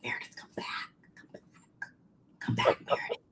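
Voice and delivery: mock whisper, pleading; hushed urgent call after someone leaving; whispered calling back. Ladies on couch calling Meredith back.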